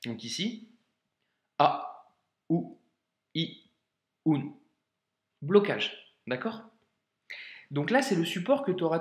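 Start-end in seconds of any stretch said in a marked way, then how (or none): 1.6-4.5 each vowel cut off at the start by a catch; short vowels each opened by a glottal stop. Shown as a demonstration. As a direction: stop the throat before every sound. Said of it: "une sorte de petit blocage dans la gorge".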